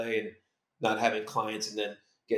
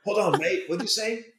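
imitated puzzled bloke, pitched up; mock disbelief in a higher voice; surprised double-take; 9 semitones higher than usual. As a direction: act out a startled "say that again?". Retelling star's puzzled reaction.

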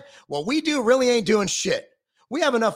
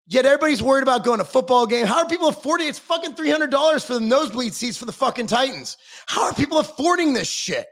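ordinary talk

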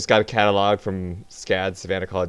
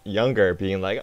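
ordinary talk, nothing marked

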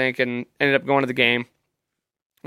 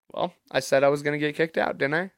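thinking to himself; 9 dB quieter than usual. Retold or imitated. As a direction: say it quietly, as a private thought. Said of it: "In my head".

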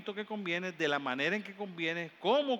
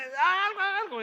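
put-on much higher, louder begging child; a frantic kid crying out; desperate child; 13 semitones higher, 8 dB louder, slower than usual. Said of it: "Y el nene". (el niño grita desesperado, muy agudo)

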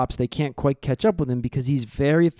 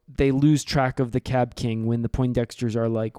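normal talking voice

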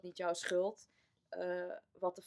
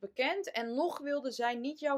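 higher, louder, real disbelief at what she hears; shocked outburst; 8 semitones higher, 10 dB louder than usual. Real, not acted. Host reacts in shock to mother's choice, loud and high. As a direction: blurt it out, stunned and indignant.

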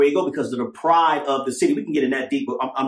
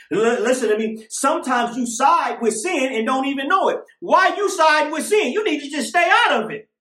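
high shouting preaching; doomsday street preacher; 10 semitones higher than usual. Acted. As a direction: shout the warning from the pulpit. Preaching at full force.

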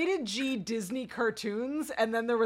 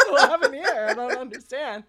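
high, loud mock confusion through laughter; mock bewilderment; 4 semitones higher, 7 dB louder than usual. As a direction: play a baffled bystander, half laughing. Acting out bewildered glitter-covered passerby.

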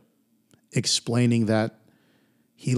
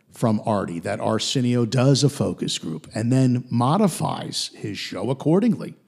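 normal talking voice

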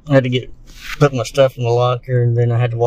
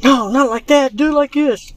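high-pitched; 12 semitones higher than usual. Acted. Acted bathroom argument.

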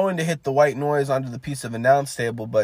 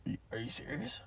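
very hushed, incredulous question; soft put-on voice of someone taken aback; impression voice; 19 dB quieter than usual. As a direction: ask it very softly, in character. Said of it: "I'm trying to do my impression here".

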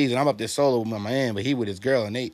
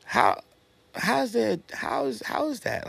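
quiet disbelief; 10 semitones higher, 5 dB quieter, slower than usual. Retold or imitated. Disbelief after losing battle.

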